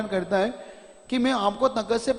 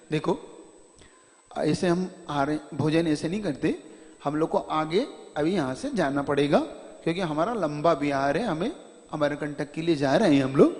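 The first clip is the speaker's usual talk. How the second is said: affectionate guru; 6 semitones lower, 4 dB quieter than usual. Retold or imitated. Guru tenderly explaining to the devotee why they must leave. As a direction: lower and softer, tender explaining. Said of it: "कितने वात्सल्य भाव से उसे कहते".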